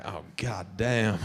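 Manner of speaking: low, slow, hushed admiration; mock lustful murmur. Man ogling a tomato at the grocery store, hushed mock lust.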